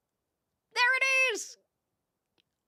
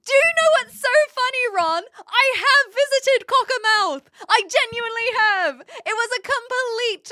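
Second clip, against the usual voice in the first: gasp, then higher and louder with excitement; bursting to share a funny coincidence; excited gasp; 11 semitones higher, 7 dB louder than usual. Real, not acted. Excited about having visited Cockermouth.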